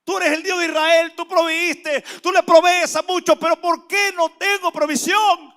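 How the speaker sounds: high, shouted plea to God; retold tearful prayer. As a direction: cry out in acted anguish, demanding an answer. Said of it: "yo lloraba y decía". (clamor angustiado a gritos, imitando el llanto)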